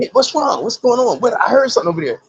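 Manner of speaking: high innocent mock-confused voice; playful clowning. Jamie Foxx playing innocent, voiced high and teasing. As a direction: play dumb, high and teasing.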